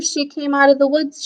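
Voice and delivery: plain reading voice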